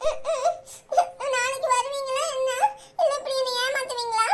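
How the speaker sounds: high, soft, teary questions; crying softly. Acted. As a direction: sniffle through the questions. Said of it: "அழக்கூடாது தங்கம்".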